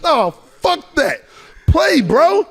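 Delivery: high, loud, slow barked order; angry shout. Retold or imitated. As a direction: snap back in anger. Oakley refusing to chill.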